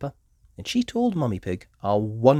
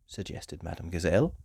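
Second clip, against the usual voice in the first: ordinary delivery, nothing marked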